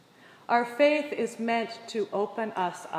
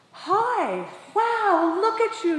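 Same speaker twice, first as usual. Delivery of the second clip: high, loud, bubbling praise for a struggling skier; cheerleading a beginner skier on the trail, much higher and louder; gushing cheer; 10 semitones higher, 6 dB louder, slower than usual. Retold or imitated. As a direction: sound thrilled to see her again. Enthusiastic woman cheers her on.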